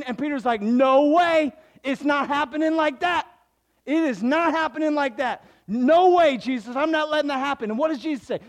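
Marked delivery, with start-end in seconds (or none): 0.6-7.7 loud, high protest, refusing outright; Peter protesting. Retold or imitated. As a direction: shout it down like you won't allow it. Peter protesting Jesus' death.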